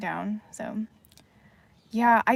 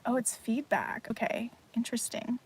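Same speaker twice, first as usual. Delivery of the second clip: whispering; 8 dB quieter than usual. Real, not acted. Quiet aside while streaming.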